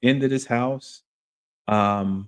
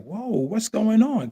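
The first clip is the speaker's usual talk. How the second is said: shocked whoa; 11 semitones higher, slower than usual. Retold or imitated. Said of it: "I was like".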